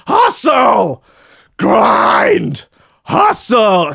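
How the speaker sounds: high, loud hype-man slogans; shouted hustle-guru chant; mock Gary Vee shouting. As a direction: yell them like a motivational hustler.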